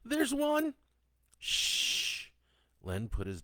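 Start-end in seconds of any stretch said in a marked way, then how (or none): 0.0-0.7 put-on high, loud child's alert; out-loud exclamation. A narrator's reading. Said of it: "Catherine said out loud". 1.4-2.3 long, loud hushing hiss; father shushing his daughter; urgent shush. A narrator's reading.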